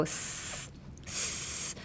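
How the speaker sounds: sustained voiceless sss; breath-filled S. Shown as a demonstration. Teacher coaching learners on breath, long hissed S. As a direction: use lots of breath to hiss the S. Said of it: "use a lot of breath".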